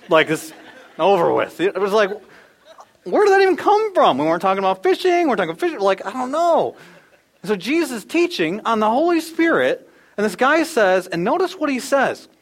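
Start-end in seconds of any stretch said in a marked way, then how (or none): 1.6-6.8 high, loud, laughing disbelief; amused disbelief. Real, not acted. A dad laughing at his daughter's random comment. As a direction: laugh it off in bafflement.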